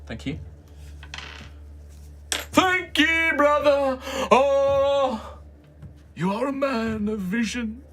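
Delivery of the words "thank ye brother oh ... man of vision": high, fervent preacher voice; street preacher. Acted. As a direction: zealot greeting a convert. GM plays the preacher.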